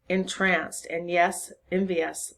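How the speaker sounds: plain reading voice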